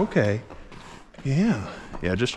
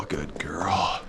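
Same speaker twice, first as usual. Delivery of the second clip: whisper; 6 semitones lower, 6 dB quieter than usual. Real, not acted. Soothing horse in whisper.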